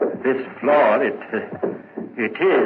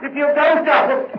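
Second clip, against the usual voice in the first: high, loud, desperate begging, slowing at the end; frantic pleading; 11 semitones higher, 4 dB louder than usual. Acted. Guilty man frantically begs the constable to leave, high and loud. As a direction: plead to leave as if the floor might give you away. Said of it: "there's no need to get so excited".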